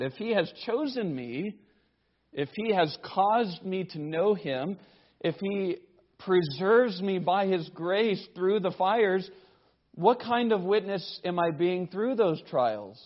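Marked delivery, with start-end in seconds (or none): none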